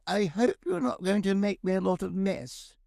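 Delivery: high-pitched, proper householder; a smart lady warning the workman; lady of the house. Acted. Lady of a smart house cautioning her plumber, high householder voice. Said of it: "a very smart house".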